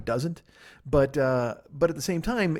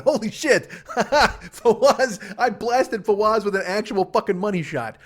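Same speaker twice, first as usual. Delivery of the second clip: laughing hard; 8 semitones higher, 8 dB louder, slower than usual. Real, not acted. Cracking up over hitting Fawaz.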